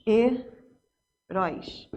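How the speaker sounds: "heróis" very slow, low and soft in two parts; syllable by syllable. Shown as a demonstration. Teacher comparing with heróico, "heróis" separated slowly by syllable. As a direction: split "heróis" into he, róis with a long pause. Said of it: "Se eu for separar".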